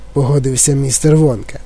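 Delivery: plain reading voice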